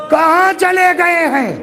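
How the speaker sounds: high, ringing rhetorical question; insistent question. Shown as a demonstration. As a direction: press the question home, pitched high. Same question repeated insistently. (زور دار، بلند سُر میں سوال)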